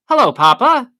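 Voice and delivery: high, loud innocent hello; over-cheerful greeting. A narrator's reading. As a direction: greet Papa too sweetly to hide something. Daughter covering up with forced greeting.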